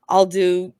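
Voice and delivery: normal talking voice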